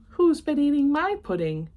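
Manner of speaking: high, loud bear complaint; first bear cries out over the pudding. Acted. Bears voiced in read joke.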